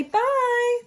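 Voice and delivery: high bright bye; chirpy birdies. Acted. Birds say goodbye to Gerald, high chirpy bye. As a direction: chirp it cheerily.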